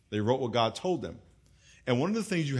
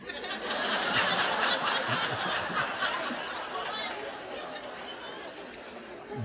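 breathy laugh; 3 dB quieter than usual. Real, not acted. Laughs at own accent.

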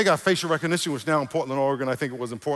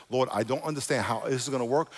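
ordinary talk

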